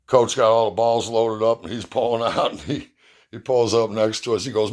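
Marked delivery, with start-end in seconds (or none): none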